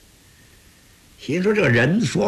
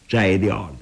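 plain reading voice

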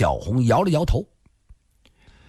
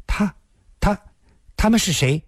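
higher-pitched, halting startled question; narrated wide-eyed alarm, kept moderate; 8 semitones higher than usual. A narrator's reading. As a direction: falter on each word, taken aback. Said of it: "林仙儿瞪大了眼睛看着他".

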